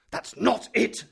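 loud, high furious shout. Acted. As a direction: bellow it in frustration. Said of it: "shouted my uncle".

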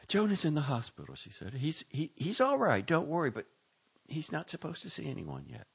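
lightly voiced, guarded mother; mother on the phone, guarded and worried; a hint of a worried mother. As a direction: reassure while holding back.

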